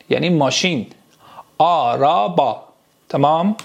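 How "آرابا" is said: slow "آرابا", every a long and open; careful Turkish pronunciation. Shown as a demonstration. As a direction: stretch "آرابا" syllable by syllable. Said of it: "تلفظ درست برید". («آرابا» کشیده و شمرده به ترکی)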